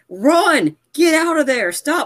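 high-pitched mock yell at the TV; retold screaming. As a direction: re-enact yelling urgently at the screen. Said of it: "I was screaming on my T V".

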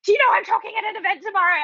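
high, fast, panicked question; frantic yell. Retold or imitated. Wife yelling down to husband from the balcony, frantic high fast.

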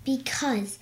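breathy unvoiced "Because"; whispered. Real, not acted. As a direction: whisper the word. Kid answers in a whisper.

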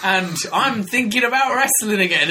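high, hammed-up yoga pitch; high upbeat parody of DDP; mock DDP. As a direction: sell it like a goofy yoga ad. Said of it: "He was like".

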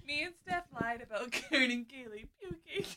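laughing. Real, not acted. Confession while laughing.

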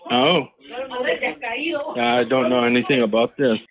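usual speaking voice

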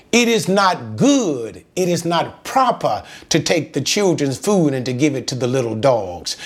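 ordinary talk, nothing marked

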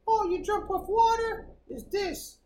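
high-pitched know-it-all voice; imitating dream interpreter. Dream reader explaining a water dream, high mock expertise. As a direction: mimic someone decoding a dream, up high. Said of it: "I know people who read books".

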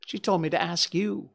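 high, meek whine; cringing character's timid protest; whining little man. Acted. Little whining man answers the salesman.

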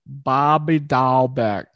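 slow, exaggerated Boston accent; terrible put-on Boston accent. Shown as a demonstration. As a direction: stretch the name out in bad Bostonian. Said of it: "That was my best Boston accent".

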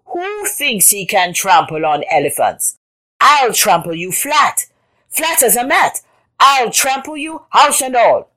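scorn building to loud, high threat; sneering threat. A narrator's reading. Elephant threatening the boaster, building outrage. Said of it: "sneered the elephant".

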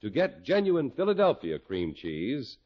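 ordinary talk

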